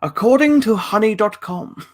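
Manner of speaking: high-pitched hammed-up sponsor pitch; mock ad plug. Mock sponsor discount request.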